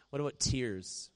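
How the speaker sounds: ordinary talk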